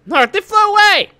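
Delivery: high, loud cartoon exclamation; excited game character.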